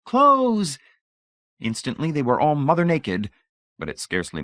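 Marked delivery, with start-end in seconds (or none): 0.0-0.8 very high, loud, frenzied yell; screaming. A narrator's reading.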